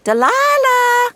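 high, loud, drawn-out shout; calling out. Acted. Girl spotting woman from her dream, excited shout. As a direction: holler the name, stretching it.

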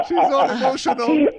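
co-host laughing at the crying host; laughing tease. Real, not acted. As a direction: laugh while teasing her.

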